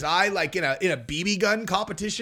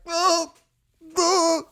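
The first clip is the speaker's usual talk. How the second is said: mock whimper; 11 semitones higher than usual. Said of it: "this kid's just like".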